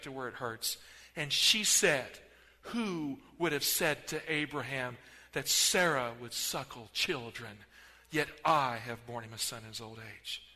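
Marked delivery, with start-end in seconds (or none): 2.6-10.4 a quieter, gloating Sarah; mild triumph. Acted. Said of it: "sound of triumph in her voice".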